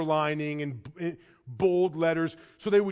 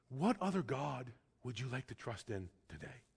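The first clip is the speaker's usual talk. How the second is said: quiet appeal; 5 semitones lower, 13 dB quieter than usual. Acted. Closing appeal to the congregation.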